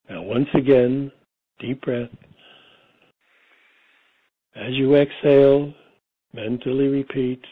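guided meditation. Real, not acted. Guiding listeners into a relaxing state, meditation voice. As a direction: lull them gently, phrase by phrase, leaving space between.

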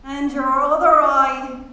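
mild, merry shout. A narrator's reading. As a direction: call it out loudly.

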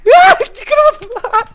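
high, slow, crying shout of defeat; frustrated wail. Real, not acted. [name] failing to break it, slow crying shout. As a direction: wail it like a sore loser.

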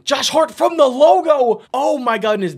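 rising hype as the ball flies; sudden gasp of excitement; excited call. Real, not acted. Logo shot attempt.

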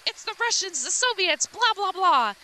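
high, mock-frantic blurting; agitated ranting. Agitated patient accuses in retelling.

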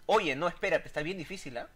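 ordinary delivery

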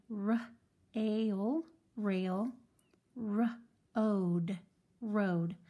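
teacher modeling the syllable rail; slow syllable. Shown as a demonstration. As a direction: rail stretched slowly. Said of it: "when we go to read the whole word".

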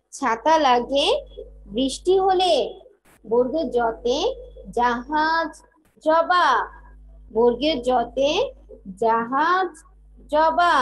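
put-on rhythmic chant, each line repeated for kids to follow; teacher chanting alphabet rhymes, slow and lilting on the first half; sing-song rhyme recital. (ছন্দে ছন্দে ছড়া আবৃত্তি)

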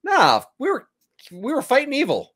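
high, brush-it-off mock protest; mock dismissive. Voices the dismissive character in contrast.

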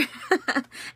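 real high, airy giggle; laughing. Real, not acted. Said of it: "why I'm laughing so much".

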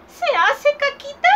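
put-on old woman quizzing the child. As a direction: squeak it like a fussy old lady. Old woman questions Robin.

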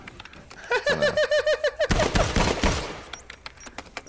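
breathless, airy high laugh; a silent, wheezing burst of laughter; wheezy laugh. Real, not acted. Laughing at his dead body.

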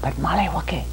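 hushed whisper of the name. A narrator's reading. Storyteller hushing children before the escape, whispering. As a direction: drop to a secret whisper.

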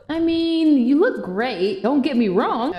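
put-on loud, haughty mean-girl voice; snobby mean friend. Snobby friend brushing off her old friend, loud and haughty. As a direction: look down on her, loudly.

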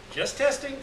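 light, high teasing; with a laugh. Acted. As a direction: toss it off with a chuckle in the voice. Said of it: "Jack said with a laugh".

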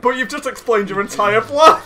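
high, loud incredulous protest; exasperated outburst. Real, not acted. Friend to would-be puncher of corpses, laughing exasperated outburst. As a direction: call out the flaw, half laughing. Mock outrage at friend's plan.

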